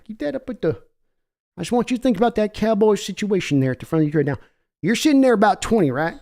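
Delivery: folksy Texan owner voice, pushy; brash Texas accent of a team owner; Jerry Jones impression. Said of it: "That was me, Jerry Jones".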